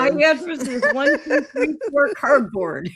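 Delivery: giggling through the words; laughing joke. Real, not acted. Joking to her cohost about her box address, laughing. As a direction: laugh while delivering the gag.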